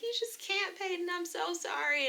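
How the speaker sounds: high-pitched imitation of tearful apology; retold tearful whine, pitched well up; mock crying. Owner apologizing to the dog while sick, mock crying. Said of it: "I'm, like, crying".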